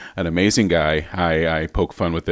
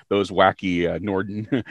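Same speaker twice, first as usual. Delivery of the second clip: ordinary talk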